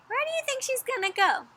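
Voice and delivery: plain reading voice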